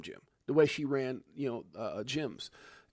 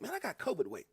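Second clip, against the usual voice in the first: lightly voiced whiny complainer, higher; mock whiny excuse; 7 semitones higher, 4 dB quieter, faster than usual. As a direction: imitate someone whining about covid weight. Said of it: "You know, like".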